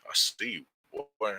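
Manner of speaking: ordinary talk, nothing marked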